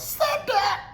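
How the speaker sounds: very high squealed mock shout; hyper yell. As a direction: shriek it like an overexcited hype man. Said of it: "acts hyper and yells".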